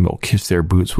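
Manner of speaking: usual speaking voice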